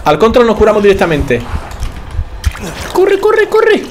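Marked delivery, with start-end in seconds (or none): 2.9-3.8 squealed, very high repeated command; frantic urging. Real, not acted. Player fleeing enemies, frantic high squeal. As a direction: squeal it in a panic to get away.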